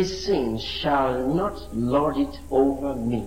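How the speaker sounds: slow, low confession of faith; a slow scripture declaration for the church to echo. Shown as a demonstration. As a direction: declare it for them to repeat.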